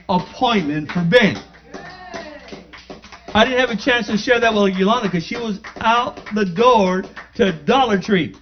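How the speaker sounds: loud, high testimony, words ringing out; fired-up sermon voice; preaching at full force. Acted. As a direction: preach it like a revival.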